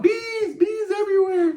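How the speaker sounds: very high, loud, hammed-up cry of "bees"; mock movie panic. Quoting a movie bee scene.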